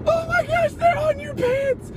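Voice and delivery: very high falsetto panic shriek; panicked mock shriek. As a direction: shriek a warning in hammed-up panic. Acting out panicked cry during attack.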